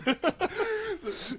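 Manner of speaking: high, wild burst of laughing; high laughter. Real, not acted. Friend can't stop laughing at the story, high laughter. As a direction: crack up completely.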